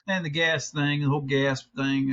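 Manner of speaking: normal talking voice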